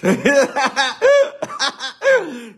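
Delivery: loud, high burst of laughter; high cackle. Real, not acted. Comedian amused by his confused fans, high cackle. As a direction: crack up loudly at your own joke. Cracks up at own joke.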